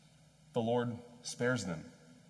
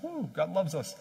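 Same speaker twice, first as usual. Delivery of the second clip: higher, light mock cheer; mock church excitement; 6 semitones higher than usual. Mock happy churchgoers, contrasted with anger.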